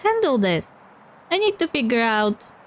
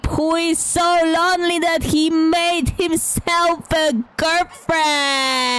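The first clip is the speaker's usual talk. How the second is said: very slow, stretched-out exclamation; exaggerated, long-held vowels of dismay; drawn-out accusation; 4 semitones higher, slower than usual. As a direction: hold the key words out for ages in disbelief. Mocking accusation, words hugely stretched.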